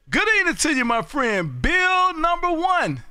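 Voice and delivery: hearty radio greeting. Real, not acted. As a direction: stretch the name out in welcome. Hailing a friend on the radio.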